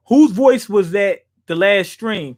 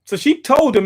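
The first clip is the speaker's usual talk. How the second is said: normal talking voice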